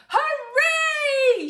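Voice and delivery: very high, shrill lady's cheer; a lady beside Sam cheering. A narrator's reading. Lady in the crowd cheering the Queen. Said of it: "shouted a lady".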